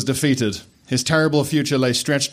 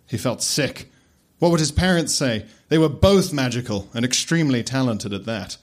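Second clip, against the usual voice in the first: plain reading voice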